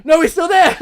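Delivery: high, loud cry with laughter in it; mock panic. Friend sees minotaur still chasing, mock panic. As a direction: shriek the bad news, half laughing.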